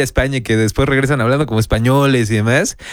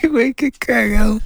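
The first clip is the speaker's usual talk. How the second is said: laughing; 8 semitones higher, slower than usual. Real, not acted. Laughing at robot-voiced question.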